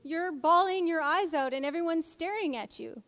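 high, exasperated mock scolding; acted-out plea to a woman in denial; imagined telling-off. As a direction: stretch the key words in playful disbelief. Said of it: "I want to be like".

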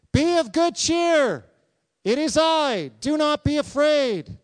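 high, loud, slow reassuring call; Jesus calling out. Acted. Jesus calling to frightened disciples, loud and high. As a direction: call across the water to calm them.

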